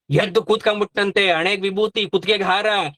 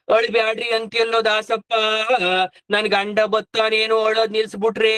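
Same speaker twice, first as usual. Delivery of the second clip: mock wailing; 2 semitones higher than usual. Elder wife refusing Dasappa's comfort, mock wailing. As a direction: imitate a widow's wail on a level note.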